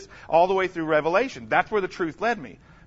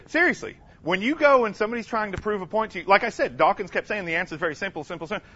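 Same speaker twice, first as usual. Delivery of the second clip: ordinary talk, nothing marked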